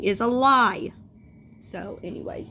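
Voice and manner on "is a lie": loud, raised-pitch verdict; emphatic debunking. Acted. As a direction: punch out the final debunk. Mocks bad pregnancy advice.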